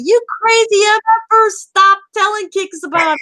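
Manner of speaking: loud, high comic rant; mock outraged scolding. Host scolding players who report bugs, mock outraged. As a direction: yell it in exasperation, playing it up.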